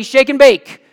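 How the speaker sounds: loud, punchy congregation cue; call-out prompt. Shown as a demonstration. Pastor prompts the congregation to shout back, loud cue. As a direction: belt it out for them to echo. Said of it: "Somebody tell me".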